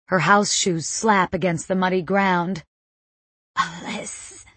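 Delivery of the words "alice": voiceless hiss, barely any sound; choked, airless whisper; strangled hiss. A narrator's reading. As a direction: hiss it out, unable to talk. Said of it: "she hisses, unable to talk".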